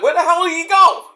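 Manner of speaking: high, loud, baffled fan; mock fan outcry. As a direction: ask it like a puzzled fan wondering where he went.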